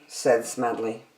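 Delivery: plain reading voice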